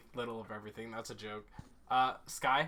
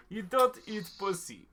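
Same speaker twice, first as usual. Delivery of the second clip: mock Russian; 3 semitones higher than usual. Annoying a friend who said stop, mock Russian. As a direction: deliver the joke in put-on Russian. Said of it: "is the Russian accent".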